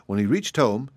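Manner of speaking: ordinary delivery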